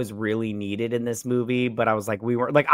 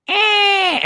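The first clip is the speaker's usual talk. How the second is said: sharp, very high, loud squeal; startled creature squeak; 8 dB louder than usual. Retold or imitated. Mimicking Rat Boy's startled squeal. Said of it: "he's just like".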